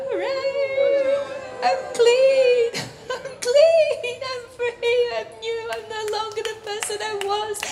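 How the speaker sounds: high, jubilant testimony cry; joyful exclamation. Acted. Preacher acts out a believer made clean, jubilant. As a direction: exult like someone just set free.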